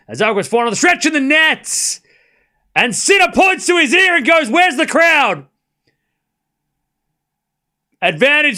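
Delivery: thrilled commentator shouting as Sinner wins the rally; excited shouting commentary. Real, not acted. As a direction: erupt as the rally ends. Big point won, commentator erupts.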